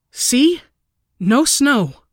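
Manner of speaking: high, loud panicked cry; frantic, near breaking. A narrator's reading. Narrator panicking at empty window to sister. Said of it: "My voice was growing more panicked".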